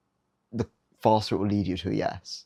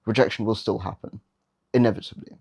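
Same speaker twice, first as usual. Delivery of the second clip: normal talking voice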